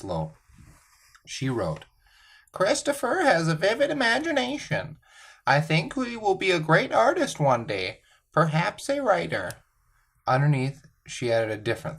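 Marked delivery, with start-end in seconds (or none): 2.5-9.5 high, sweet teacher voice. Retold or imitated. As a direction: read it like a fond teacher. Teacher's report card voiced.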